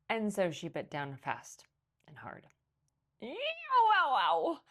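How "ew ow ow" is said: loud, high pained yelps; wailed. A narrator's reading. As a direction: yelp as the beak bites. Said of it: "Missus McSnoot wailed".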